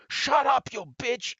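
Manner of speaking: mock furious husband yelling back; angry caller. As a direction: bark it at someone off the phone. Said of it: "the guy on the phone says".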